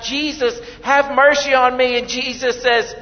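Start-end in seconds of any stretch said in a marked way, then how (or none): 0.0-2.0 higher and louder, pleading cry for mercy; passionate cry. Retold or imitated. Blind Bartimaeus crying out to Jesus, loud higher plea. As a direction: call out to him across the crowd. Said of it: "he screamed out, he passionately cried out".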